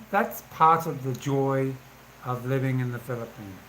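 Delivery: usual speaking voice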